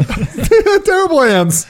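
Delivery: high laughing remark. Real, not acted. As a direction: laugh while you say it. Laughing about receiver.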